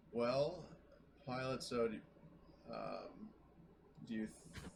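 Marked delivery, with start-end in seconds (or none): none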